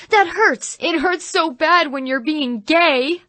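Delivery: high, loud, slow overacted pain; hammed-up wail. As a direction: wail it like a bad church play. Actor wailing under paddling.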